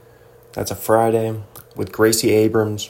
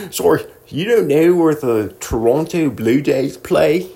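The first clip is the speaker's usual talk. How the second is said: put-on polite Canadian accent; 4 semitones higher than usual. Host imitating Canadian asking about Blue Jays, polite mock Canadian accent. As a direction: ask with exaggerated Canadian politeness. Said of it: "you have to be polite about it".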